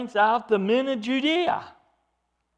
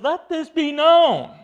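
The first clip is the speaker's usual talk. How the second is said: loud, high, slow proclamation; slow preacher's shout over the noise; emphatic proclamation; 8 semitones higher, 9 dB louder, slower than usual. Acted. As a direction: announce it over a noisy crowd. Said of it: "Very emphatic in the Greek".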